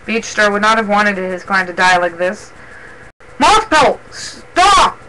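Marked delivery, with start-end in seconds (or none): 3.4-5.0 high, urgent shout; desperate high yell; screeched warning. A narrator's reading. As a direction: cry out sharply to halt him. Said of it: "Robin Wing screeched".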